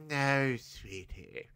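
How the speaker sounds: a slow, lightly played mid-Atlantic drawl; mid-Atlantic accent. Host voices Rhea in a mid-Atlantic accent. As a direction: purr it in an old-Hollywood mid-Atlantic accent. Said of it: "That's my mid-Atlantic accent".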